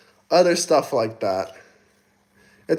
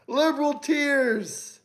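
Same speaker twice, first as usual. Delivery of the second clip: high, slow sneering jeer; a slow, high gloating jab; mocking taunt; 9 semitones higher, 7 dB quieter, slower than usual. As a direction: savor it, drawn out and high. Taunting viewers over liberal tears.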